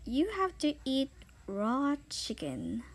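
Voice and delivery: usual speaking voice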